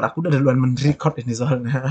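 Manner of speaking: normal talking voice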